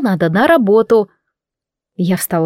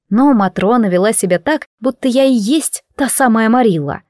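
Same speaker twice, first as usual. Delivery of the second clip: ordinary delivery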